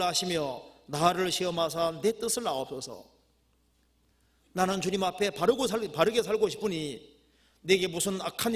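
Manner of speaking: fervent scripture prayer. Acted. Preacher praying David's psalm before the congregation, fervent declamation. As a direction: cry the prayer out to God, easing at the end.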